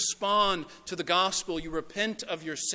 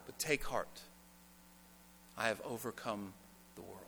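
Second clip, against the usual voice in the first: tender quiet preaching; 4 semitones lower, 8 dB quieter than usual. Acted. Soft reflective preaching.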